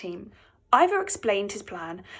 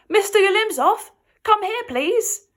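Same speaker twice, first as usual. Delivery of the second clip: calling out; 8 semitones higher, 5 dB louder than usual. A narrator's reading. Nat calls out to wrestler.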